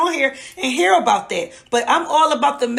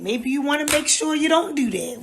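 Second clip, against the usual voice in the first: ordinary talk, nothing marked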